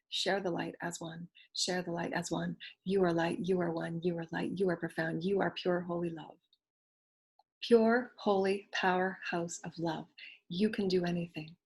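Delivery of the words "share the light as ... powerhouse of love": guided affirmation. Acted. Teacher softly affirming the listener, meditation voice. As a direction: lull them into believing.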